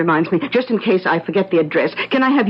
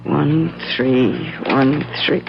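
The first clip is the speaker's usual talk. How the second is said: slow reading aloud; 3 semitones lower, much slower than usual. Acted. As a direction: spell out each digit very slowly. Reading out a phone number.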